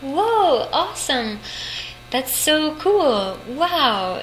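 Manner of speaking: high, slow, amazed gushing; retold thrill, pitched high and drawn out; excited. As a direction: gasp it out like a kid who just got the part. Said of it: "And I was like".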